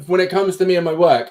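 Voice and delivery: usual speaking voice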